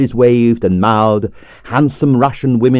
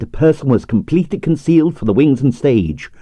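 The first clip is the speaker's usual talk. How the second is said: ordinary delivery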